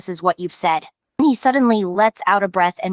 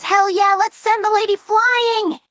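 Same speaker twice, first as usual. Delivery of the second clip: hyped Jax; 13 semitones higher than usual. Retold or imitated. Narrator imitating Jax cheering before the launch, excited whoop. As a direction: belt it out like a reckless show-off.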